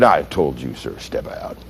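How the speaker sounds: very low, hushed command; subdued repeated order. Retold or imitated. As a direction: sink down to a near-whisper for the order. Officer repeats order, at a loss.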